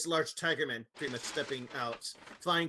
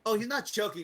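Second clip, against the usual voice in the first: ordinary talk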